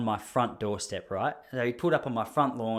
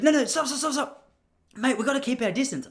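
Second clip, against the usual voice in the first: panicky postman; 11 semitones higher than usual. Retold or imitated. Postman ordering the speaker to keep away, high and panicked. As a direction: yelp it like a terrified postie. Postman halts him again.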